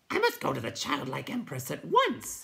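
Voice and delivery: hammed-up mournful creature voice; a mock lament, pitched a little up. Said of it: "he said mournfully".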